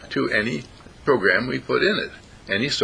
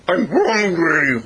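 high, loud, slow dog howl; doggish talking; 8 semitones higher, 5 dB louder, much slower than usual. Acted. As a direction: stretch it out like a howling dog. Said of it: "And the dog said".

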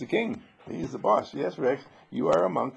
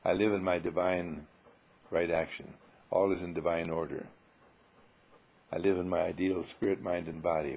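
softer, lower, calm self-affirmation; soft, lowered affirming voice; 7 semitones lower, 5 dB quieter than usual. Shown as a demonstration. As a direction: recite it gently like a quiet prayer. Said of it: "give ourselves just one label".

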